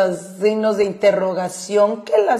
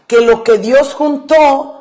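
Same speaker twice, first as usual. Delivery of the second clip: "juntó" lento, con la O final cargada; acento en la O; 2 semitones higher, 3 dB louder than usual. Shown as a demonstration. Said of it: "acento en la O". ("lo que Dios juntó" despacio, con peso en la O de "juntó")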